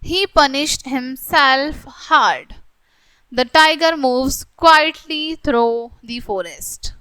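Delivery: slow, deliberate read-aloud of the example sentences; slow textbook reading. Acted. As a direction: read each word out slowly for the class.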